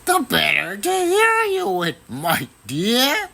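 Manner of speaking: a sly wolf hiding behind a granny voice; wolf posing as granny. A narrator's reading. Wolf in grandmother's bed answers the girl, high and sly.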